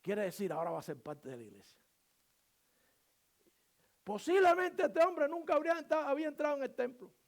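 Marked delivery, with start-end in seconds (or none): 4.0-7.1 raised, loud pulpit voice; impassioned, high and loud sermon delivery; full-force preaching. Real, not acted. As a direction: drive each phrase home to the congregation.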